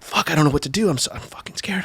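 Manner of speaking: scared self-talk, fading to breath; retold fear. Filmer facing a fight, retold in frightened undertone. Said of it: "I was so, so scared".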